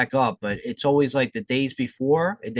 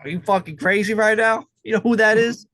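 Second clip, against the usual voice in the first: loud, high incredulous outburst; excited; 7 semitones higher, 5 dB louder than usual. Real, not acted. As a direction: shout it in disbelief.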